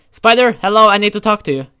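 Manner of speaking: loud, high call into the cave. Acted. Searching the cave for Spider, a loud high call. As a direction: call ahead to find her.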